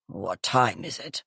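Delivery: low, soft, croaky woman's voice; thick and croaky. A narrator's reading. Woken in the night, groggy and croaky. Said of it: "thick and croaky".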